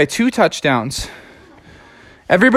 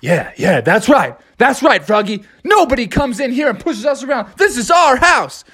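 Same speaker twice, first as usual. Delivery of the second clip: higher, rising hype; team cheering; 7 semitones higher than usual. Acted. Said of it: "they all were like".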